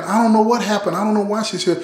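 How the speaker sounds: a hint of a scared kid pleading innocence; panicked son.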